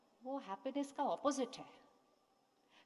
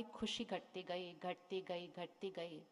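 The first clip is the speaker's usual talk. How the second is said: fading away; 4 semitones lower, 6 dB quieter, slower than usual. Acted. Happiness shrinking, repeated.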